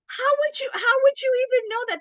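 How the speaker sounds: high-pitched, incredulous retort; acted astonishment, voice shooting way up. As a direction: react in squeaky disbelief. Acted reaction to teen's quote.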